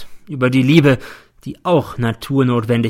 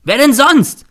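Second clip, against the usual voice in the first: much higher, louder furious yell; angry shout; 9 dB louder, slower than usual. A narrator's reading. Boy Z accusing N, furious shout. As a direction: hurl the question at him. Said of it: "schreit der Z".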